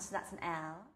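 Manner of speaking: ordinary delivery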